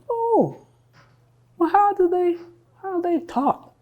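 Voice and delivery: high, wondering inner voice; musing aloud. Acted. Cathy studying the soldiers she plans to imitate, high musing. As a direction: lift it high as she puzzles it out.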